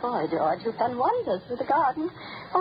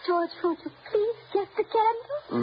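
higher, crying voice, trying to stay polite; tearful pleading; 7 semitones higher than usual. Acted. Old woman pleading with gardener through tears. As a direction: beg through tears, hiding the fear.

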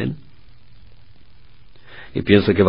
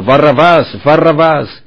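crowd shouting; 7 semitones higher, 6 dB louder, slower than usual. Acted. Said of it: "todas gritan al unísono".

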